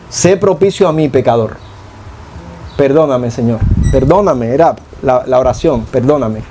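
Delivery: low humble whisper-like plea for forgiveness; contrite plea. Retold or imitated. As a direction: sink the voice like a sinner beating his chest. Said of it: "no se atrevía a levantar los ojos".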